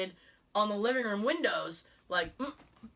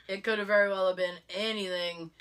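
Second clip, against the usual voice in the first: ordinary talk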